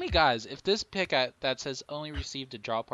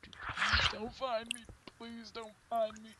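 high, slow, tiny pleading voice; squeaky pleading voice; 11 semitones higher, 3 dB quieter than usual. Pretend. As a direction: beg in a squeaky little voice. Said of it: "I'm just like".

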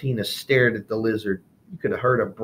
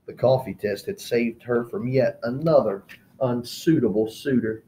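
ordinary delivery

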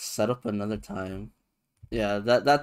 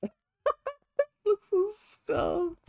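very high, slow, drawn-out squeak; high squeaky voice; slower than usual. Pretend. Repeats phrase in high put-on voice.

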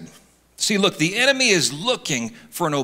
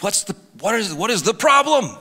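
mock exasperation, climbing higher; hammed-up impatient griping, pitched up; worked up; 5 semitones higher than usual. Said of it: "you start getting worked up".

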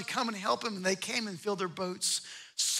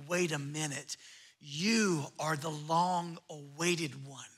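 awed hush; 6 semitones lower, 8 dB quieter, slower than usual. Acted. Pastor voicing awed Peter.

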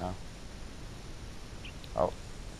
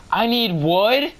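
incredulous question; 7 semitones higher, 3 dB louder, slower than usual. Real, not acted. Disbelief at game demand.

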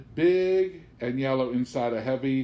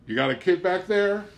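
higher and louder, startled at the shadow; alarmed question; 8 semitones higher, 5 dB louder than usual. A narrator's reading. Bubba's friend spots a shape in the van, alarmed. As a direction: blurt it out in surprise.

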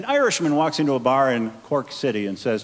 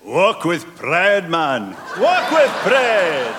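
slow, higher Scottish rallying call; proud Scotsman; 3 semitones higher, 4 dB louder, much slower than usual. Acted. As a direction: proclaim it slowly with Scottish pride. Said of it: "And the Scotsman yelled".

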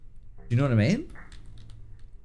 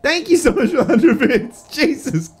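laughing off fear; 13 semitones higher, 7 dB louder, slower than usual. Real, not acted. Still shaken after the scare.

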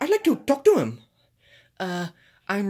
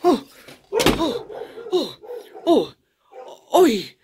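screaming; 6 semitones higher, 5 dB louder, slower than usual. Acted. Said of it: "you like to scream".

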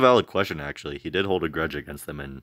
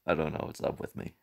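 laughing; 3 semitones lower, 10 dB quieter, faster than usual. Real, not acted. Laughing at herself.

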